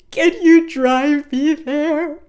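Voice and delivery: high, loud, slow wail with a sob at the start; mock crying. D W asks Grandma Thora for a ride, mock-sobbing. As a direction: whine it like a sobbing little kid. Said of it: "And then she starts crying".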